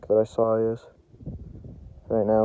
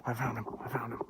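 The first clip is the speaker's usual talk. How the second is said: hushed; 10 dB quieter, faster than usual. Real, not acted. Drops voice near the deer.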